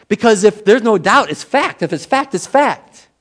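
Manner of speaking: high-pitched, emphatic insistence. Real, not acted. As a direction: drive it home high and punchy. Preacher insists with rising emphasis.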